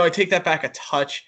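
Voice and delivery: ordinary talk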